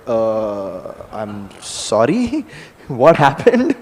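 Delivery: a mild dazed mumble; lightly voiced confused god; bewildered Jagannath. Jagannath caught off guard.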